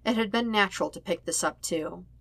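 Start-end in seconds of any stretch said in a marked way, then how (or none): none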